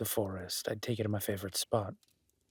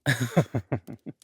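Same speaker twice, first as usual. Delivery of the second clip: short laugh; 3 semitones higher, 8 dB louder than usual. Acted. Host laughs at guest.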